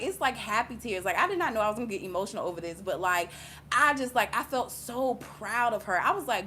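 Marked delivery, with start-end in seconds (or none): none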